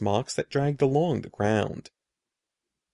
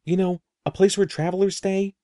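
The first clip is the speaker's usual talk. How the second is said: raised, higher and louder explaining; girl explaining to a puzzled boy, impatient and raised; 7 semitones higher, 4 dB louder than usual. A narrator's reading. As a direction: spell it out, running out of patience. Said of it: "I cried impatiently".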